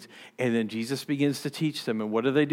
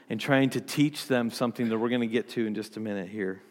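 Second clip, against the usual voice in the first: normal talking voice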